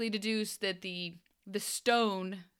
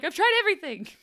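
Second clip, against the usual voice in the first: exasperated quote; 11 semitones higher, 7 dB louder, faster than usual. Retold or imitated. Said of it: "she's like".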